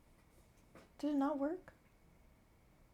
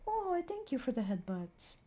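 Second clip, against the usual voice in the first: ordinary delivery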